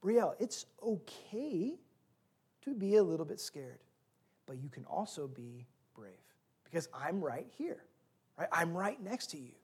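soft, gentle reassurance; calm her fear quietly; reassuring father. Retold or imitated. Father to scared daughter, soft reassuring voice. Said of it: "Rather, my response was".

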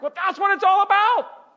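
loud, high-pitched cry; exasperated outburst. Acted. Preacher answering people indifferent to the gospel, exasperated shout. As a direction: burst out at full volume.

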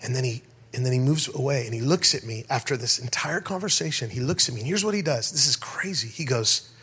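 usual speaking voice

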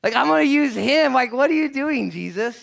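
high, very loud outburst at Jesus; incredulous shout. Acted. Preacher playing someone questioning Jesus, incredulous shout.

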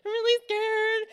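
high-pitched fake tearful wail; exaggerated whimpering complaint, pitched high; mock whining. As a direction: whine it out, imitating a near-crying meltdown. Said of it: "'Cause I was all like".

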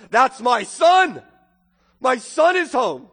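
loud, slow cry of joy; joyful father's cry. Acted. Father sees his lost son on the road and shouts for joy.

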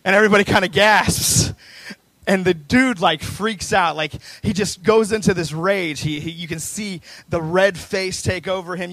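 loud, heated storytelling from the pulpit; dramatic preaching. Acted. Preacher tells the crowd of a man's rage, heated preaching. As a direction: build the confrontation at full voice.

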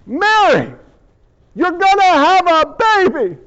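high, emphatic proclamation; grand angelic announcement. Acted. Gabriel announces the baby to Mary, proclaiming grandly. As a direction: announce it big, with weight on each phrase.

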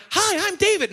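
Mickey Mouse voice. Pretend. Pastor voicing David for a toddler, high cartoon mouse voice. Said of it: "my David sounds like Mickey Mouse".

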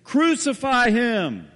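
loud, high, slow mob cry; crowd shout. Retold or imitated. The crowd answering Pilate, a slow loud roar. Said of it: "the crowd answers in unison".

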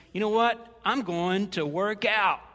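loud, emphatic self pep talk; bold resolution. Acted. Preacher acting out his food-plan resolve to himself, loud and determined. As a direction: declare it big, stretching out the vow.

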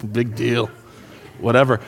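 low, soft, unimpressed shrug of a voice; bored teenager. Pretend. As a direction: brush it off like a bored teen. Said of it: "You young people are like".

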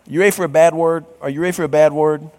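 quick teasing build-up; speeding up to reveal. Acted. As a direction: rush the tease before the reveal.

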